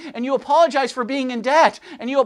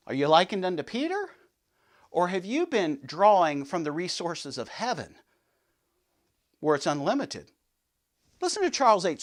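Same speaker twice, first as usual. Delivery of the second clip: ordinary talk, nothing marked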